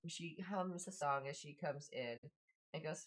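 ordinary delivery

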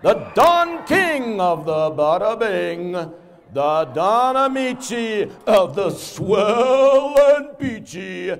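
booming showman intro, building; hype announcer. Acted. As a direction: hype the crowd like a ring announcer. Hypes up the show's star.

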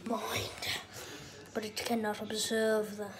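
hushed documentary narrator whisper; nature documentary. Pretend. Dad narrates dog like documentary.

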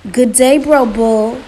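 loud, drawn-out mock-sad greeting. A narrator's reading. Spider greeting Bro Bull for sympathy, sad voice. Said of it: "said in a sad voice".

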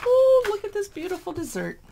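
high, loud delighted ooh over the picture; thrilled cry of admiration; excited gush. Real, not acted. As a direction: gush like you just spotted treasure. Gushing over a dessert photo.

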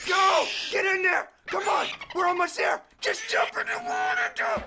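high, panicked shouts; frantic urging. Real, not acted. Player urging a stranded dolphin into the water, frantic shouting.

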